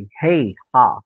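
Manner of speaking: ordinary delivery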